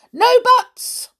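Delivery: very high, loud retort; cutting off. Acted. Stubborn listener silencing her friend, loud retort. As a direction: shut it down with a squawk. Stubborn character cuts off protest.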